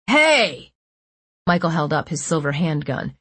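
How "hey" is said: sharp angry shout. A narrator's reading. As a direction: yell to cut them off. Gunman shouting at captives.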